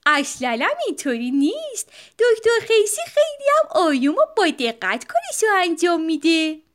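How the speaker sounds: a mother setting the record straight; firm mama bear. Acted.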